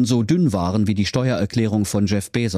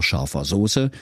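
ordinary delivery, nothing marked

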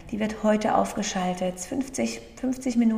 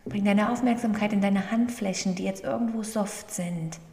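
guided meditation. Acted. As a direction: gently guide the breathing, unhurried. (ruhige Meditationsstimme)

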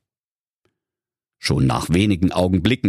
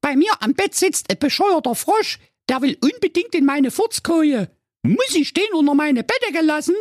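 put-on very high squeaky daddy's girl; piping, put-out girl voice; complaining princess Carola. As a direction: whine to your father about the frog.

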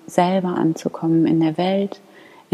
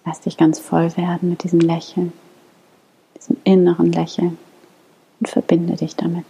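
guided meditation. Acted. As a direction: lead them gently into calm prayer. Leads a guided morning prayer.

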